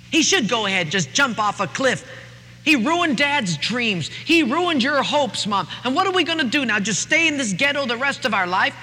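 put-on young daughter ranting about her brother, high and angry; angry sister. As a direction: rage at your foolish brother. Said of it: "flies into a rage".

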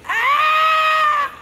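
very high, loud shrieking scream; blood-curdling scream. Retold or imitated. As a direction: let out a hammed-up horror shriek. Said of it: "this blood-curdling scream".

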